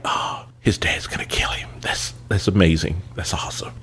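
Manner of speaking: soft unvoiced gloating whisper; gleeful whisper. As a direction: whisper it like a guilty pleasure. Retold gleeful thought at wreck.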